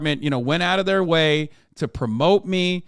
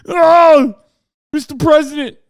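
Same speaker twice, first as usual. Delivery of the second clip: hammed-up wailing New York cop, high and shouted; New York cop accent; 12 semitones higher, 10 dB louder than usual. Host as crying cop apologizing to Trump, shouted New York accent. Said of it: "wouldn't have that kind of accent".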